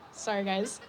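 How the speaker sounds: soft unvoiced sorry with a little laugh; whispered apology. Real, not acted. After asking the crowd if she's too loud, a breathy whisper. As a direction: hush it down after catching yourself being loud. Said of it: "am I talking really loud?".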